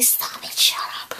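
whispered stop it; mom hushing. Retold or imitated. Mom hushing her daughter in the store, whispered. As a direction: hiss it like an embarrassed mom. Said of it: "kept on telling me that I was freaking crazy".

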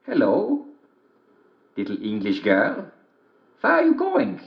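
high sly wolf voice; big bad wolf sweet-talking a girl. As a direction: greet her like a cunning wolf. Wolf greets girl in story.

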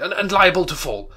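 loud protest; remonstrating. Acted. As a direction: cry out in alarm. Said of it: "Scrooge remonstrated".

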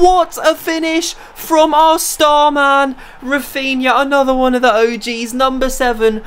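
soaring commentator shout for the goal; goal commentary. Acted. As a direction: scream the goal home like a commentator. Live play-by-play of the goal.

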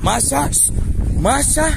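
high call across the yard; high shout to the dog. Real, not acted. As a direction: call her name out high. Calling the dog.

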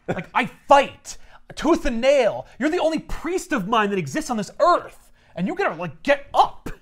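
high, loud, aggrieved god; indignant rant. Acted. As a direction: act out an outraged deity's tirade.

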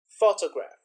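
"Photograph" with a loud, strong first syllable; first-syllable stress. Shown as a demonstration. Teacher demonstrates word stress, "Photograph" stressed on the first syllable. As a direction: punch the opening syllable of "Photograph". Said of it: "hear the stress in the first".

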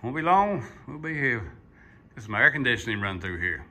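normal talking voice

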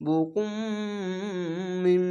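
বুকুম ending on a held nasal ম; ghunna. Shown as a demonstration. Teacher shows the ghunna at the end of বুকুম. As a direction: close বুকুম with a humming ম. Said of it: "এটিও গুন্না".

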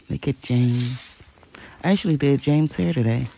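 ordinary talk

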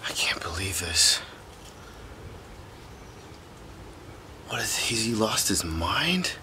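low, whispery muttering to himself; soft, shaken aside; hushed disbelief. Acted. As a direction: murmur in stunned disbelief. Alone outside after friend's rampage.

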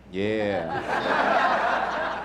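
low, long drawn-out "yeah"; clueless bluff. Retold or imitated. Friend bluffing when tested on his Spanish, clueless. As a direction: stretch it out low and unsure.

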